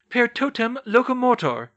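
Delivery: loud, high commanding incantation; incanting. Acted. McGonagall at the entrance incanting the statues awake, loud. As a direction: ring the spell out like an order. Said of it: "where she incanted".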